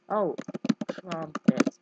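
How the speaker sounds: usual speaking voice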